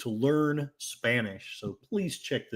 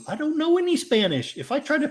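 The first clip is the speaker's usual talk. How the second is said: exasperated squeal; 13 semitones higher, 4 dB louder than usual. Real, not acted. Stumped by the Spanish name.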